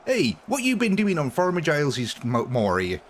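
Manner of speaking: hammed-up West Country farmer accent; mock West Country yokel accent. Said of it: "the West Country as they call it over here, accent".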